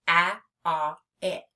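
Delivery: short a, ah, short i, each apart; isolated short vowels. Shown as a demonstration. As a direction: pronounce "A, a, it" as bare vowel sounds. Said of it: "has a vowel".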